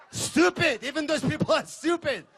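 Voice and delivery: high incredulous rant; mock outrage. Real, not acted. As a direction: throw your hands up at these people. Ranting about catch-and-release fishermen.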